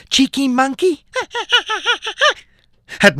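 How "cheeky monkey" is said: cheeky monkey. Acted.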